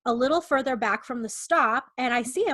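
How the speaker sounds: ordinary talk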